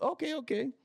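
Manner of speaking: high, meek driver voice; imitating the driver. The driver caving to his passenger, high and meek. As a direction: give in fast and timid. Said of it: "And he's like".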